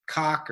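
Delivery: Koch with an open "ah" and hard K; Koch as "cock". Shown as a demonstration. Author trying another version of the name, Koch as "cock". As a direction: say Koch to rhyme with "lock". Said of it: "sometimes I pronounce it".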